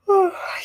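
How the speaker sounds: a long, real yawn. Real, not acted. Streamer tired in an empty house, a real yawn.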